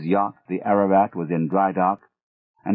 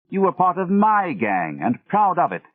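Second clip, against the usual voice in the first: put-on raised, proud British voice of an old master; Gregory's British accent; 8 semitones higher than usual. A narrator's reading. Gregory telling the narrator he was in his gang, British accent. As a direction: reproach them like a wounded British mentor. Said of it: "Gregory went on in that British accent of his".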